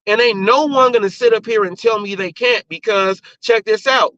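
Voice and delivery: ordinary talk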